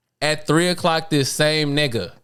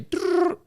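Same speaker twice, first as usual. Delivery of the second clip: intercom chime noise; 13 semitones higher, 3 dB quieter than usual. Retold or imitated.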